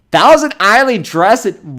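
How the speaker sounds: loud, high, slow build of amazement; amazed read-out. Real, not acted. Reading recipe, growing amazement.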